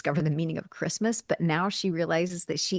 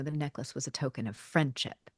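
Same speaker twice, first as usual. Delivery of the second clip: softer, hushed confiding; quiet; 5 semitones lower, 9 dB quieter than usual. Retold or imitated. Billy quietly telling Jo about the necklace, hushed. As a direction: say it low so only she hears. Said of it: "he just quietly tells Joe".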